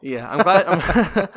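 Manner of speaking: a laugh spilling into the words; real, amused laughter. Real, not acted. Laughing at the jail joke.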